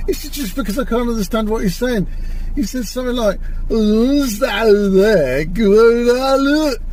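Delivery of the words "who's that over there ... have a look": slow mock imitation of the Doctor's accent, louder; the Doctor's accent, parodied slowly. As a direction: drawl it out, sending up the Doctor's accent. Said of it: "what the fuck kind of accent is this".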